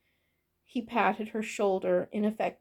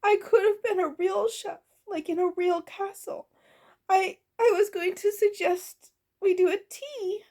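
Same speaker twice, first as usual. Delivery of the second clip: high-pitched, woeful lament; wailing goblin cook; 11 semitones higher than usual. A narrator's reading. Goblin cook mourns becoming a real chef, wailing. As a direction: wail over a lost dream. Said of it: "Orla wailed".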